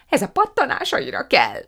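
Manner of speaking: high, loud bellow. A narrator's reading. Prue corrects Fan néni, bellowing. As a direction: roar it as if to someone dim. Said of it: "bömbölte Prue".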